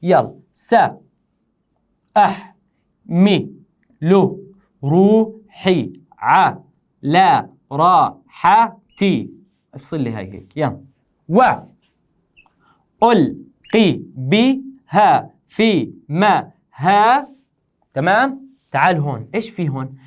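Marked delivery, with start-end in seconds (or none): 0.5-9.3 very slow, each syllable stretched and set apart; syllable-by-syllable scansion. Shown as a demonstration. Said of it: "بنقطع هذا البيت". 11.3-17.3 slow, isolated syllables with long vowels; syllable-by-syllable scansion. Shown as a demonstration. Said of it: "بنقطع هذا البيت".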